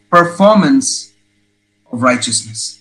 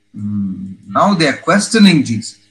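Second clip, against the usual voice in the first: normal talking voice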